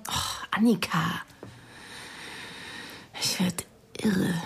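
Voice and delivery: whispered, worn-out sigh of a complaint; exasperated whisper. Acted. To Annika, who keeps asking, exasperated whisper. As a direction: breathe it out under your breath, fed up.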